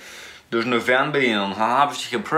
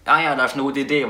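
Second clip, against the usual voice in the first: normal talking voice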